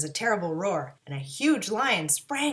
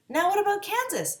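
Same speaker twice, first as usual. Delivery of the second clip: eager; 12 semitones higher, 4 dB louder than usual. A narrator's reading. Dorothy eager question.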